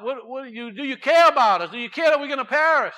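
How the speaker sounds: high, loud mock panic in the storm; panicked crying out. Disciples waking the master in the storm, loud panic. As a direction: cry out like frightened men in a sinking boat. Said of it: "they're crying out".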